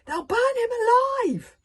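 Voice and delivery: high, loud desperate yell; boy yelling that his dragon will burn; urgent yell. A narrator's reading. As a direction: cry out in alarm. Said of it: "yelled Hiccup".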